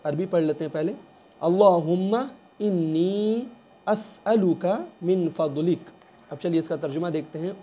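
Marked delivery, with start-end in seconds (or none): 1.4-5.8 slow, devout recitation of the Arabic; dua recitation. Acted. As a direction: recite the prayer reverently. (अदब से दुआ की तिलावत)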